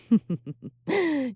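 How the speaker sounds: high, knowing demon laugh; teasing laugh. Acted. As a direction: laugh at what she imagines. Teasing about the hunter's fantasies.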